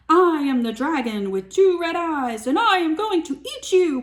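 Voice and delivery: loud, high mock dragon threat; hammed-up dragon boast; dragon roar.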